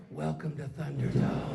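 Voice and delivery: low, hushed mock menace; soft, deep, theatrical welcome; ominous whisper. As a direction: murmur it low into their ear. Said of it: "you whisper in their ear".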